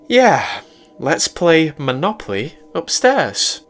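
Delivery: a sly yes with a wink in it; girlfriend voice. A narrator's reading.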